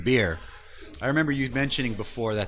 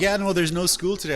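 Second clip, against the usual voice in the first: put-on higher, louder sly kid voice; higher mock child insisting; fibbing daughter; 8 semitones higher, 4 dB louder than usual. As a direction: sell the lie like a cheeky kid. Daughter fibbing about school.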